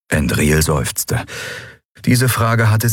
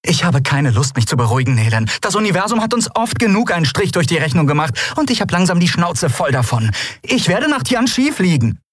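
higher, exasperated outburst; fed up; 12 semitones higher than usual. A narrator's reading. Fed-up woman refusing her friend's soothing, exasperated. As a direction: refuse to calm down, pitch raised.

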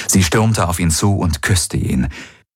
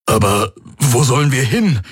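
higher, worried question; urgent questioning; 7 semitones higher than usual. A narrator's reading. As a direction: ask it pitched up, at a loss. Anxious question while fleeing.

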